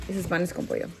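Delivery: low-key repeat of the dish name; quiet repeat. Real, not acted. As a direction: say "panes con pollo" again, softly. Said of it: "restating the dish name".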